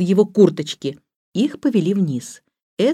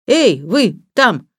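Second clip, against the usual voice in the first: louder hail, word by word; calling out; 4 semitones higher, 5 dB louder, much slower than usual. A narrator's reading. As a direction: hail them through a closed door. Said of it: "крикнул он". (громкий оклик)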